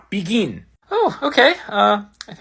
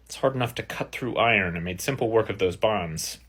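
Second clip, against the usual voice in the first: usual speaking voice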